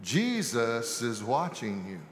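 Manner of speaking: low, soft mysterious voice. A narrator's reading. Mysterious voice warns a burglar in a home, very soft. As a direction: say it softly, pitched low. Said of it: "a very soft voice say".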